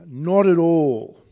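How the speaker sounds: slow, loud and firm: not at all; emphatic loud denial. Retold or imitated. Preacher voicing Paul's flat refusal, emphatic and loud. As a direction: stamp out the idea, slow and loud. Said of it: "He's quite emphatic".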